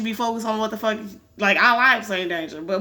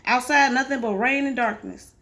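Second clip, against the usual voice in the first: ordinary delivery, nothing marked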